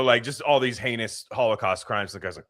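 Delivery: usual speaking voice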